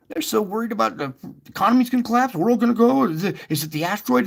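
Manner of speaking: fast, rising alarmed rush; hammed-up worried rush; mock panic. Mocking the world's panic.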